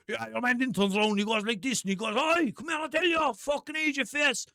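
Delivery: high-pitched parody of an estate man; hammed-up Irish council estate father voice. Shown as a demonstration. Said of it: "That's his voice".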